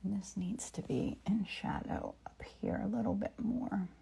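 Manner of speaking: soft whisper to herself. Real, not acted. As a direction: whisper as if painting alone. Whispered painting narration.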